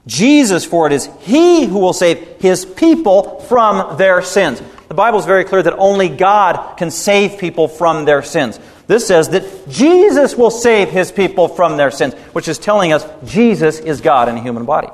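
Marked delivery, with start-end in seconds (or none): 0.0-4.6 loud, slow proclamation of the verse; proclaiming preaching. Acted. Preaching proclaims name of Jesus. 8.9-12.1 emphatic preaching. Acted. Preaching stresses verse again.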